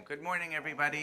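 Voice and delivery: high, loud call to the crowd. Real, not acted. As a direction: pump up the room, call it out. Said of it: "Okay, one more time".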